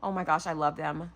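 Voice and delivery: ordinary talk, nothing marked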